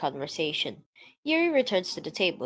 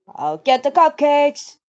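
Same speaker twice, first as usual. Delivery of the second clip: put-on cheerful girl voice; 7 semitones higher, 9 dB louder, slower than usual. Voicing a club member.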